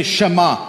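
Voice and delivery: "shema" said clearly, sh-uh-MAH, stress on the last syllable; model word Shema. Shown as a demonstration. Pastor models "shema" for everybody to repeat. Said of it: "Everybody say".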